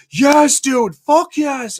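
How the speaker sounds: high, drawn-out hype shout; stretched out excited yes; hyped cheer. Retold or imitated. Hyped owner cheering in kitchen.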